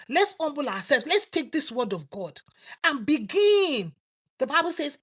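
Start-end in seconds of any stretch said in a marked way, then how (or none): none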